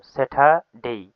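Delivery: slow, drawn-out স্যাটারডে with "ঠ" for the t; aspirated T demonstration. Shown as a demonstration. Teacher shows that T sounds like ঠ, slow aspirated demonstration. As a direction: say স্যাটারডে slowly as "se-tha-dei". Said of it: "টি না, এটা টি হবে".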